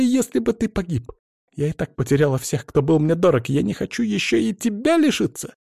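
high tearful, frightened voice; tearful pleading. Acted. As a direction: plead through tears after a scare. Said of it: "навзрыд выкрикнула она".

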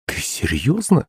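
taken aback, rising query; incredulous exclamation. A narrator's reading. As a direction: exclaim it in soft disbelief. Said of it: "воскликнула маман".

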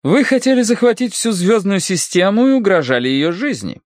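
high, severe character voice; stern accusation. A narrator's reading. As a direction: list the charges sternly, pitched high. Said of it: "сурово заявила Серафима Маркеловна".

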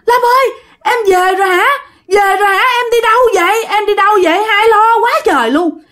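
high-pitched excited calling out; crying out. A narrator's reading. Older sibling calls to missing Lam coming home, high mild cry. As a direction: call out high to a returning loved one, without full shouting. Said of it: "bà mới gào lên".

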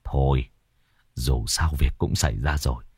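low, soft resigned village chief; resigned sigh. Acted. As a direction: let it go with a heavy sigh. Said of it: "ông trưởng bản thở dài".

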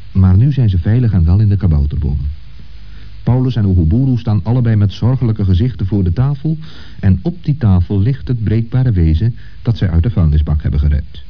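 ordinary delivery

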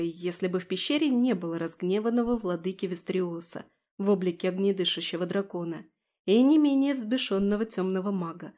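plain reading voice

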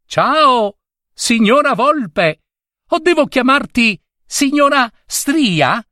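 gleeful mocking squirrel voice; taunting demon squirrel. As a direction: crow down at the trapped fox from a treetop. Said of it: "gridò alla Volpe Stria".

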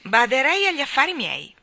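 high, loud tart rebuke; acid comment. A narrator's reading. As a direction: snub them with sour disdain. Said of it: "commentò acida".

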